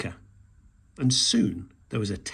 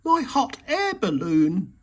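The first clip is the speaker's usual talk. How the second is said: cried out; 9 semitones higher, 4 dB louder than usual. A narrator's reading. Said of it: "he cried".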